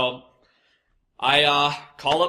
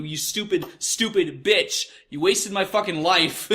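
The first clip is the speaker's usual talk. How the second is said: imitated furious rant; 5 semitones higher than usual. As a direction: vent the silent rage at the customer. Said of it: "And I'm like".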